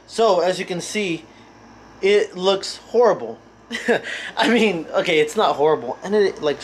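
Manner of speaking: normal talking voice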